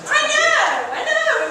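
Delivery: squealing agreement. Acted. Excited agreement.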